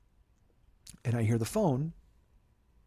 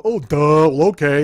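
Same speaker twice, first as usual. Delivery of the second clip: loud, dopey mocking voice; hammed-up clueless agreement; mock dumbass; 10 dB louder, slower than usual. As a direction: play a gullible dummy, much too loud. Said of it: "a dumbass who's just gonna go".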